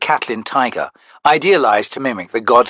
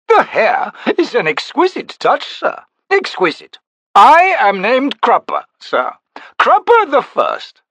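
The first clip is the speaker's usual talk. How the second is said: much higher, gushing chatter; fussy little Crupper; 10 semitones higher, 3 dB louder than usual. A narrator's reading. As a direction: flatter the tall stranger eagerly.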